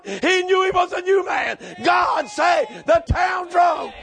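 impassioned preacher building to a holler; full-force preaching. Acted. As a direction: tell the testimony high and loud.

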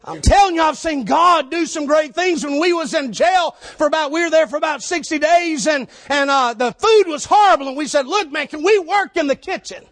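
high loud shouted preaching; preaching at full force. Acted. Street preacher telling the crowd Jesus loves them, shouting. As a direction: drive it home like a street preacher.

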